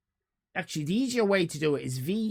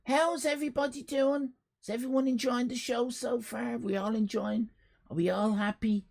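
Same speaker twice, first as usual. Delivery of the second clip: high, put-on crowd check; put-on streamer check-in; 6 semitones higher than usual. Streamer asking viewers if they're enjoying it, put-on high check-in. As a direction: pitch it up like a streamer checking on everyone. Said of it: "I, I better do it".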